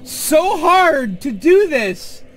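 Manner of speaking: high, loud, slow despairing cry; exasperated outburst. Real, not acted. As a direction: plead with the game, half laughing. Laughing despair at the game.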